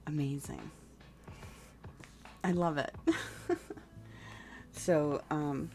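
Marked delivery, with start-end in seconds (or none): 3.0-4.7 light giggle. Real, not acted. Delighted laugh.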